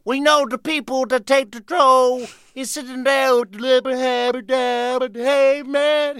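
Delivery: high, drawn-out comic impression; Bill Cosby. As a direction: stretch the words out, way up high, rambling into nonsense. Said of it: "I'd do, like, Bill Cosby".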